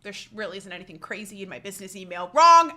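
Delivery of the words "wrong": a sudden, much louder shout of Wrong. Real, not acted. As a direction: blurt it out loud as the punchline. Punchline correcting her own assumption.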